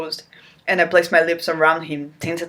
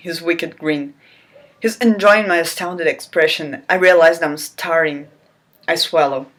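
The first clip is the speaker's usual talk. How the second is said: normal talking voice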